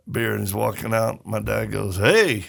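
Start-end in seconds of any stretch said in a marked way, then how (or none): 2.0-2.4 retold call-out to a stranger. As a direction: imitate dad hailing someone. Dad calls out to stranger.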